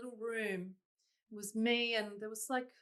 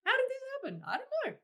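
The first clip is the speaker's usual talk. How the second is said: jumps way up high, squeaky and amazed; squeaky disbelief; much faster than usual. Retold or imitated. Singer recalling her Carnegie Hall debut, high squeaky disbelief. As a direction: squeal it like you can't believe your luck.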